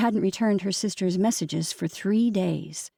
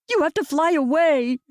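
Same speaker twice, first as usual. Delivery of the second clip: higher, louder, catching breath; hiccuping sobs; 7 semitones higher, 4 dB louder than usual. A narrator's reading. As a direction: sob it out between hiccups. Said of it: "she hiccuped as she tried to catch her breath".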